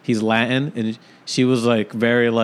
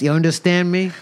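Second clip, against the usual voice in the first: usual speaking voice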